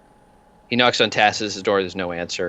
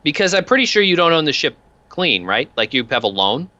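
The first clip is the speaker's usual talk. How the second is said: higher-pitched, lightly voiced thug pressing with questions; needling debt collector; 5 semitones higher than usual. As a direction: talk like a wheedling loan enforcer scoring a point. Peanuts needles the ship's captain.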